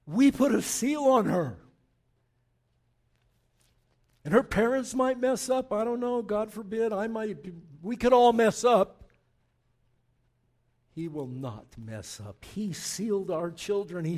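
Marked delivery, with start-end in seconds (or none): 0.0-9.0 louder, higher pulpit fervour; rising preaching. Acted. Pastor urges parents at the baptism, fervent and loud. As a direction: lift it up with urgency.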